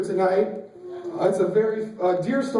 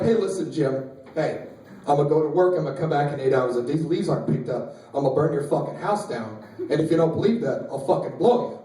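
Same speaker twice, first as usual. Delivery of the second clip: low, controlled mock threat; menacing threat; 7 semitones lower than usual. Fed-up man to neighbor about leaves, mock menacing. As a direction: warn him low and matter-of-fact.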